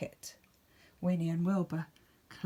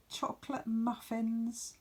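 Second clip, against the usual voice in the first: usual speaking voice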